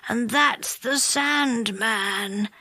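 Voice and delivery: slow, soft, half asleep; sleepy. A narrator's reading. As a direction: drift off mid-sentence, very drowsy. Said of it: "said Moonface sleepily".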